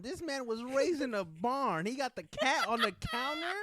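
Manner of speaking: mock outrage. Roasting his friend for the cat on the counter, in loud laughing mock outrage. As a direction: lay the scandal on thick, laughing.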